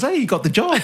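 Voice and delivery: high, chirpy boss voice, pitched well up; a cheery high boss voice delivering the news. Retold or imitated. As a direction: play the oblivious boss breaking good news brightly. Boss cheerfully announces the promotion.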